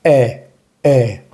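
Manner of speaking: E as open "eh", like è; isolated open E, French grave-accent sound. Shown as a demonstration. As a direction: open the mouth wider on E. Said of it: "un poco más abierta".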